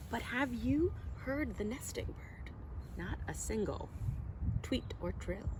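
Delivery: hushed storytime question. Acted. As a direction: lean in and ask it softly. Refrain read to children.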